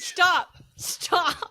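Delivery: stop, half laughing; laughing protest. Real, not acted. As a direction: plead while giggling.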